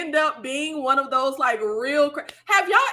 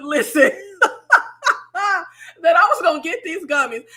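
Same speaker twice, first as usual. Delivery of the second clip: laughing; 4 semitones higher, 3 dB louder than usual. Real, not acted. Laughing recounting.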